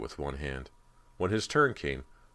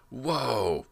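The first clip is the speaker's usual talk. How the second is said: high, pained cry; a boy crying out in pain as the coach resets his shoulder; cry of agony; 8 semitones higher, 3 dB louder than usual. Acted. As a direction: yelp as the arm is shoved back in. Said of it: "Brant cried in agony".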